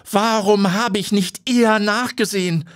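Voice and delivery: high, anguished wail of regret; crushed by the loss; grief-stricken cry; despairing lament. Acted. Owner despairs on her knees.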